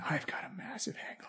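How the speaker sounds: unvoiced hungover whisper. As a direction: rasp it out, too sore to speak.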